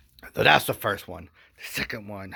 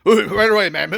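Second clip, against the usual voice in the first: higher, louder eager guard; 11 semitones higher, 12 dB louder than usual. Retold or imitated. Guards answering the wife, in an eager raised voice. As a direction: snap to it like an obliging guard. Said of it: "guard answers again".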